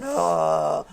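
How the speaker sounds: long low groan of dismay; drawn-out imitated groan; mock audience groan. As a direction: groan like a let-down crowd. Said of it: "and you hear the groan".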